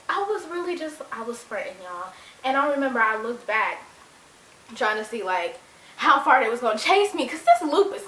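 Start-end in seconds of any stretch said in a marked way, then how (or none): none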